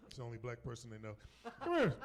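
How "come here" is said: high, loud mock summons; eager calling over. Proud parent calling the nurse over, high and eager. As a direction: beckon loudly in a raised voice.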